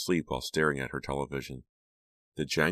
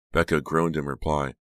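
ordinary delivery

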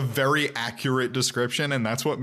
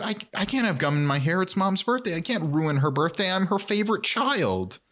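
higher-pitched comic panic; mock freaking out; 6 semitones higher than usual. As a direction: plead in a hammed-up fluster. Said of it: "Max starts freaking out".

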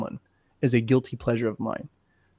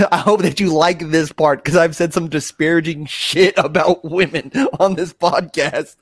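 laughs through the words, a bit louder; chuckling while apologizing to his mom; laughing; 3 semitones higher, 6 dB louder than usual. Real, not acted.

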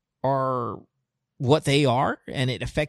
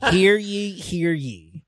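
mock town crier call; 4 semitones higher, much slower than usual. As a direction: proclaim it like a herald. Mock proclamation on birthday.

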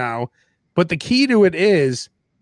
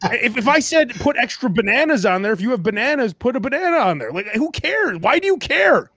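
exasperated rant; 6 semitones higher than usual. Retold or imitated. Continues exasperated rant about the order.

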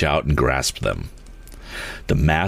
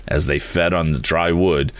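ordinary delivery

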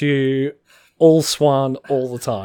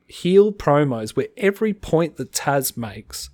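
ordinary delivery

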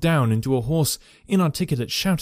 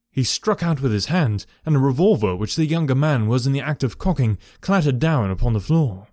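usual speaking voice

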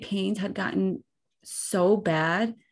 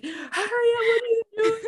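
high pitched panicked cry at the driver; frantic yell. Retold or imitated. Wife in labor yelling at husband to hurry up, high frantic cry. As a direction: screech it out like a woman in labor.

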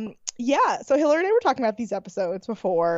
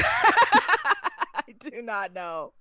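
laughing; 4 semitones higher, much slower than usual. Real, not acted.

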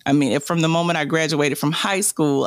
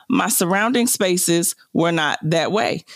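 ordinary delivery

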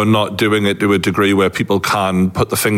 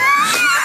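burst of high laughter. Real, not acted. Laughing at own slip.

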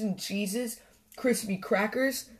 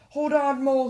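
loud, high-pitched call; urgent cry; 7 semitones higher, 5 dB louder than usual. A narrator's reading. Badger racing to rescue Mole from the tree, urgent cry. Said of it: "he cried".

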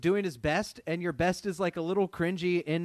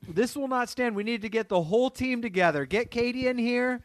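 raised, urgent pretend orders; rally the whole team in hammed-up outrage; loud, high-pitched boss act; mock angry boss; 5 semitones higher, 5 dB louder than usual.

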